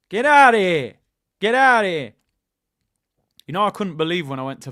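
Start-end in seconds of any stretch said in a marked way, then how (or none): 0.1-2.1 loud, high Italian-American movie wiseguy; Italian-American accent. Pretend. Streamer mimicking Philly old guys, loud Italian-American accent. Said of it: "talking like the, the Italian Americans in the film".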